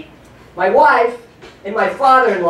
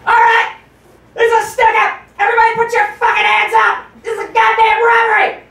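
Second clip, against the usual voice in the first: robber's shout; 12 semitones higher than usual. Pretend. Comedian acting out a bank robbery with his wife, shouting. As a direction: bark it like a bank robber with a gun. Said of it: "be like".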